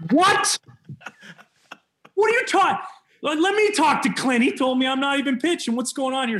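very high, loud squawk of protest; hammed-up panic, easing slightly as it goes; shocked disbelief. Player told he's in after all, hammed-up disbelief. Stunned reaction to being sent in.

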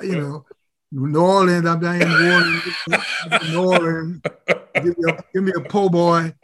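slow, hammed-up Louisiana drawl; mock Southern drawl. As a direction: parody a coach faking a Southern accent. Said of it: "he had a Southern drawl".

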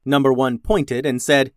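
plain reading voice

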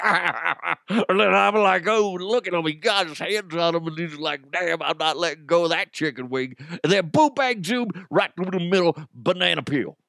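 high, exaggerated sportscaster impression; blustering comic play-by-play; John Madden. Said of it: "attempted to do a John Madden impression".

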